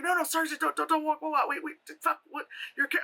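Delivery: high, tumbling, stammered imitation; flustered excited fan. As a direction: imitate fans tripping over words, high-pitched. Acted fans stopping her in recognition.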